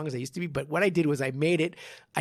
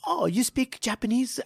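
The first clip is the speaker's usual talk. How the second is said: higher-pitched imitation of a fake Japanese accent; put-on Japanese accent, pitched up; mock Japanese accent; 8 semitones higher than usual. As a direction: mimic someone faking a Japanese accent. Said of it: "fucking Japanese accent".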